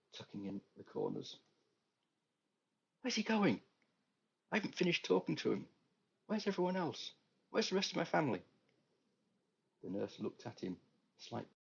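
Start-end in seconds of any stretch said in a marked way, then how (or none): 3.0-8.4 much higher, louder, rushed bewildered questions; anxious, bewildered. Acted. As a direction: panicky demands, voice climbing high. Bewildered patient calling anxiously.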